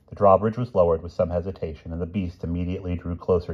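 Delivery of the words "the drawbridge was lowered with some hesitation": ordinary delivery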